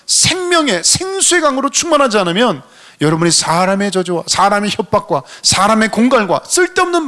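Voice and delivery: high-pitched, sustained sermon crescendo; fervent preaching. Real, not acted. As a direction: ride high through the phrases with preacher's fervour. Preaches at full force. (높은 음으로 열렬하게 몰아가는 설교)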